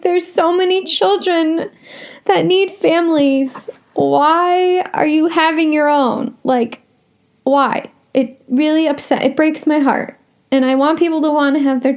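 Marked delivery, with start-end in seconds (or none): 0.0-7.9 exasperated rant. Retold or imitated. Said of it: "I just wanna, like, scream".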